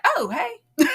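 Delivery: high, loud sheepish greeting with a laugh; sudden switch to a laughing hello; caught mid-rant. Retold or imitated. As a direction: re-enact being interrupted mid-rant. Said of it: "in the middle of cussing about men".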